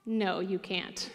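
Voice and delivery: slow, soft, dead-serious put-down; blunt deadpan refusal. Retold or imitated. Coach looking eager freshman straight in the eyes, deadpan. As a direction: let the air out slowly and quietly.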